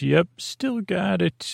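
slow, high-pitched retold quote; voice going up. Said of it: "his voice goes up".

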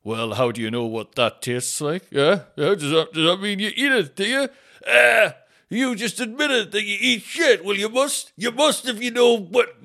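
high, loud, goading office joker; obnoxious would-be comedian, building to gleeful accusation; office joker character. Acted. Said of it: "one person in the office who thinks it's funny".

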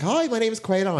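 high, eager retold hello; enthusiastic greeting. Greeting a blind date outside Topshop, high and enthusiastic. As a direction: chirp a high, over-keen introduction. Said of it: "all my enthusiastic, um, self".

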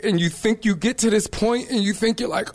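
ordinary delivery

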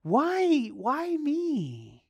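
high, questioning voice for why me; bewildered why me. Acted. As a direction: play Matthew's puzzled wonder. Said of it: "he was probably asking himself".

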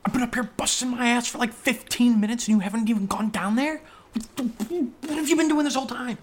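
high-pitched exasperated outburst; incredulous, voice shooting way up; indignant. Acted. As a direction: scold in high-pitched disbelief. Acted indignation at partner's inaction.

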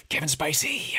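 a reluctant, sighing groan of a name; mock pained sigh. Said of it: "have to kinda go".

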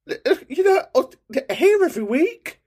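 very high sing-song teasing. Pretend. Host mocking a caller who is always there, high sing-song. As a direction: rib him in a high silly voice.

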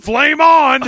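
loud high shout; shouted catchphrase. Retold or imitated. Host acting Freddy in flames, shouted catchphrase. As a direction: yell it out triumphantly. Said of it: "fl-, fl".

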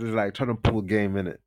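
usual speaking voice

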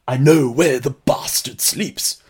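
slow, overwrought bad English accent; hammed-up Hollywood attempt at an English accent; awful English accent. Host imitating Keanu Reeves in Coppola's Dracula, awful English accent. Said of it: "an English accent that is pretty damn awful".

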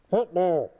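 imitating a muffled recorded voice. Patient mimicking what the blocked ear heard, muffled. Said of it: "it was all muffled".

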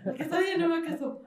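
lightly voiced trembling kid; imitating a scared kid. New boy pleading with local kids, mock scared. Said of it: "Pobre niño, de que temblando".

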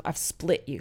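ordinary talk

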